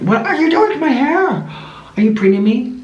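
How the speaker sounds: high squeaky pet voice; speaking as the parrot; bird voice. Pretend. Voicing bird being preened.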